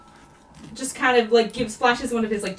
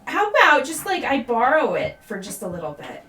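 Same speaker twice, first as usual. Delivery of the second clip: put-on higher and louder, eager pleading kid; higher-pitched, bouncy begging voice; happy wheedling child; 6 semitones higher, 4 dB louder than usual. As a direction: coax him sweetly like a bratty child. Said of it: "back to very happy again as he smiles and just goes".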